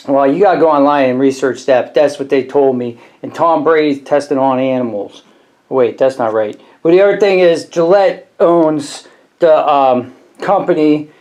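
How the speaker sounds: normal talking voice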